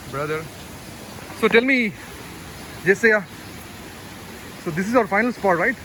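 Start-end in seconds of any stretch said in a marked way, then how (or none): none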